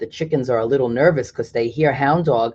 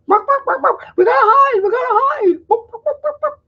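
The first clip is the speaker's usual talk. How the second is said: much higher squawking panicked hens; chicken imitation; 4 dB louder than usual. Teacher playing the panicked chickens for kids, squawking. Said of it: "That's my chicken imitation".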